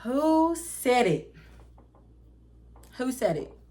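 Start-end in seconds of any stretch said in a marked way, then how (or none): 0.0-1.3 slow, high, indignant demand. Retold or imitated. New employee hunting the rumor's source, demanding. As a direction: draw the accusation out, pitched up.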